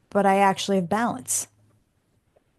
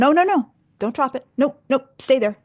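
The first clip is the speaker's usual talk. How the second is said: high, loud no no no, barking her back up; urgent shout; 7 semitones higher, 4 dB louder than usual. Retold or imitated. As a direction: yell at her not to drop. Instructor stops her dropping.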